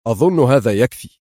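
mildly sharp interjection; a touch of sharp authority. A narrator's reading. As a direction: cut in with some edge. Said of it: "وهو يقول في حدة".

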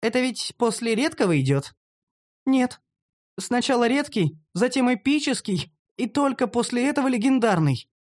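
high female character voice; explaining gnome. Acted.

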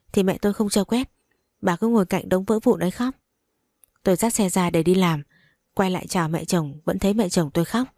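ordinary delivery

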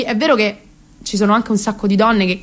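ordinary talk, nothing marked

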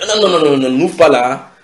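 loud, high string of refusals; frantic protest. Acted. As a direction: plead urgently to stop them.

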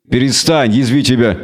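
ordinary delivery, nothing marked

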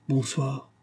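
soft, sorrowful goodnight; saddened goodnight. A narrator's reading. Husband answering his departing wife, saddened. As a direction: let the sadness sit in it. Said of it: "d'un ton attristé".

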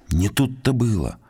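plain reading voice

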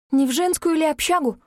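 mildly alarmed, questioning guess. A narrator's reading. Heroine wonders where the angry dragon runs, alarmed.

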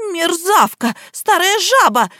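high-pitched furious insults; shrieking. A narrator's reading. Kit's wife abuses Nika, shrieking. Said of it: "визжала жена Кита".

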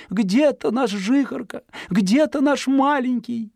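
high, lamenting voice; grieving. A narrator's reading. Cat and sparrow grieving for Zhikharka, lamenting high. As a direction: mourn the lost little one.

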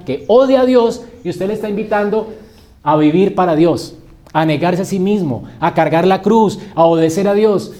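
voz fuerte que golpea cada frase de la lista; prédica enfática. Acted. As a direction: exhorta con vehemencia a la congregación. Predica a plena voz. (cadencia insistente de predicador)

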